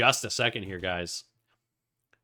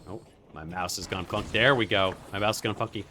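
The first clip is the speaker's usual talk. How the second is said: ordinary delivery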